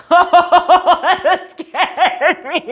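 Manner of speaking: slow, loud, laughing it out; relieved laughter after a fright; real startled laughter, slowed right down; laughing shock. Real, not acted.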